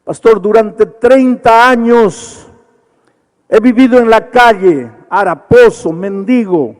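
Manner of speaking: growing to an impassioned loud lament; building anguish. Retold or imitated. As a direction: let the drunk's confession swell into a cry. Drunk's story rising to anguish.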